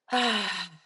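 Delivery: long open exhale on an "ah"; deep breath sigh. Real, not acted. Therapist settling into her seat before meditation, deep sigh. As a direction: breathe out in one audible sigh. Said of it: "Deep breath".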